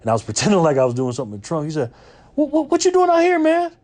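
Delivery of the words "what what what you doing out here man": high calling voice. Retold or imitated. Celebrity voiced calling from car.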